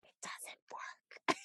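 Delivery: very soft, slow, voiceless whisper; mock whisper. People puzzled by country and corporate girl, whispering. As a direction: whisper it as if baffled. Mimics baffled people reacting.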